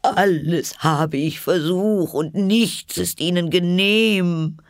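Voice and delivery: high, slow, sobbing lament; wailing pigeon. A narrator's reading. Pigeon despairs over the snakes, sobbing. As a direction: wail it in a high bird voice. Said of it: "fuhr schluchzend fort".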